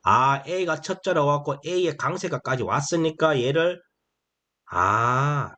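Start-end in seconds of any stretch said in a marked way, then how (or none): none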